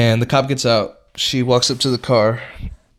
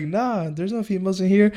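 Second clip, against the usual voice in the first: higher, laid-back no; casual denial; 9 semitones higher than usual. Retold or imitated. The boys denying to the cop, higher casual voice. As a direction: play it cool, pitched up. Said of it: "We're like".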